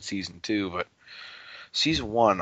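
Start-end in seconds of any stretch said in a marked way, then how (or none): none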